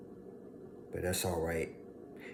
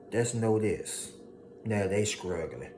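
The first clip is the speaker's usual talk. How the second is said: normal talking voice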